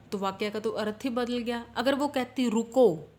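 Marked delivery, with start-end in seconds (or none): 2.5-3.1 रुको said very loud with a falling end, then a pause; a loud command, रुको, standing alone; stop after रुको. Shown as a demonstration. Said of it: "रुको के बाद comma होता". (ज़ोर से 'रुको', फिर विराम)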